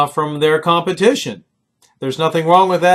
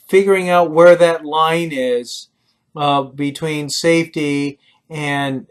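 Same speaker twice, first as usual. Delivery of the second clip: ordinary talk, nothing marked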